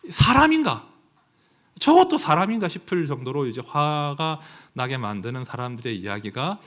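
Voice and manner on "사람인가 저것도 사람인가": indignant outcry. Real, not acted. Indignant cry at cruel people.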